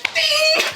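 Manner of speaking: very high ringing "ding"; imitated ding. Storyteller making the wrench hit the dog's head, high ding. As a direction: imitate metal striking, high in the voice.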